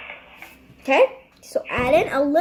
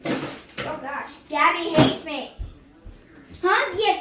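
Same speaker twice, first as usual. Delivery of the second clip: ordinary talk, nothing marked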